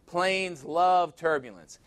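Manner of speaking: usual speaking voice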